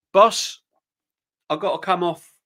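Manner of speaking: player asking off. Retold or imitated. Acting Rice asking off.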